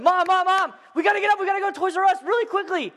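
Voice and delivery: put-on high, loud, urgent child voice; hammed-up excited little boy; pestering kid. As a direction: badger mom in a rush. Child pestering mother at seven.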